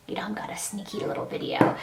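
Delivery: hushed whisper. Real, not acted. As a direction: whisper it like a secret. Telling of secret filming.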